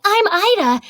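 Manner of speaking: lightly voiced character line, pitched high; high character-style self-introduction; Ida. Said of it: "my video that was like".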